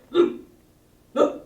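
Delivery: lightly imitated tough grunt. Girl imitating the rapper's grunt from a picture, mock tough. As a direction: grunt it like a hard rapper, loud. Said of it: "He's just like".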